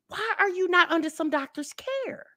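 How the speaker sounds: usual speaking voice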